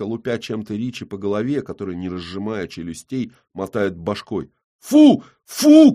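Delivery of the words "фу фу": very high, loud dog command; shouting at dog. Acted. Kolyan shouts at the dog, very high and loud. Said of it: "кричит на собаку Колян".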